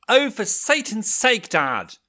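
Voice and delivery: exasperated daughter shouting. A narrator's reading. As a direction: shout it fed up with the parents. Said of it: "his daughter shouted".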